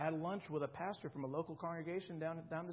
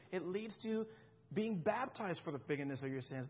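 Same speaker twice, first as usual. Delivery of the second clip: ordinary talk, nothing marked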